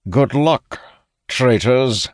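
loud, high gloating jeer; wicked taunt. A narrator's reading. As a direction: taunt them with a wicked smile. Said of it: "flashes us a wicked smile".

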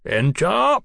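a curator's resonant summons; ringing call. A narrator's reading. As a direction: call out Enter with authority. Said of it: "rang the voice of the curator".

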